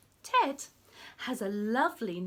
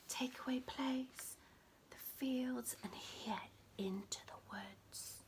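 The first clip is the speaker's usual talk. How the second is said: whisper; 14 dB quieter than usual. Acted.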